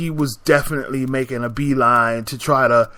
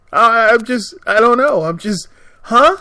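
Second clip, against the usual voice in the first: higher and louder, lost for words; a baffled huh, pitched up; stunned; 8 semitones higher, 6 dB louder than usual. Real, not acted. Telling listeners how stunned she was, in high loud bewilderment.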